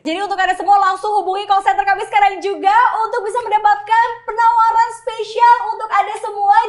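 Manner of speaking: bright, rising hard-sell pitch; infomercial. Acted. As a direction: push the viewers to call now. Closing call to action.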